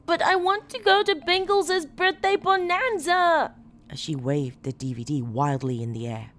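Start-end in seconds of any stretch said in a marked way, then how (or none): 0.0-3.6 put-on high shrieking little girl; screaming child. A narrator's reading. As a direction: shriek it like a tantrum. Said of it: "heard her scream".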